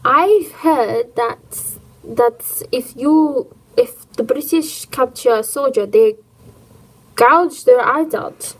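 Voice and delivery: light put-on German accent, slowed; a mild, slightly hammed German accent. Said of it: "I've got to put a German accent on".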